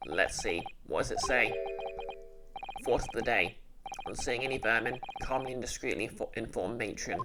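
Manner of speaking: low voice, puzzled; a deep-voiced young man thinking aloud; puzzled male sidekick. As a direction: sidekick voice, pitched low and wondering. Male character reads notice.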